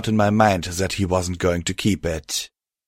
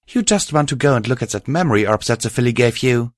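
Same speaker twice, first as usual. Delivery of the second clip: ordinary delivery, nothing marked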